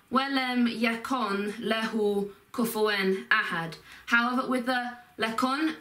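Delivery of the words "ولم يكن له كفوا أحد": separate phrases. Shown as a demonstration. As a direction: say "ولم يكن له كفوا أحد" unlinked, each word apart. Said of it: "the actual, um, phrases in this are".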